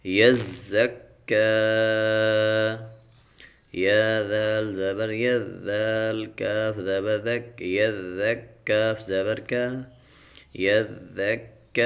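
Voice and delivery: slow, measured syllable chant; tajweed spelling. Shown as a demonstration. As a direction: recite each letter slowly. Spelling recitation continues.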